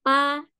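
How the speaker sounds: "pa" high, level and stretched; first-tone demo. Shown as a demonstration. Teacher showing how B A is read, high level "pa". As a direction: hold "pa" on one high note. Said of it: "Ingat, nada satu".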